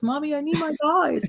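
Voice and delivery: put-on high little-kid voice, eager; a small boy begging for his toys; toddler voice. Mom imitating her son asking for his action figures, high eager childish voice.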